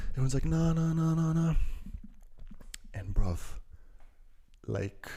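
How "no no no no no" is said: high put-on chorus of refusals; high, rapid-fire imitation of refusing; mock refusal. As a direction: imitate people turning you down, pitched high. Acts out everyone refusing.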